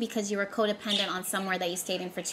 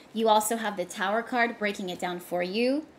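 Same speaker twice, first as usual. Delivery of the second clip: ordinary delivery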